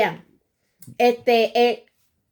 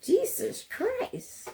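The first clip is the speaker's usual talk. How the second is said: swooning; 13 semitones higher, 8 dB quieter, much slower than usual. Pretend. Swooning over a handsome man.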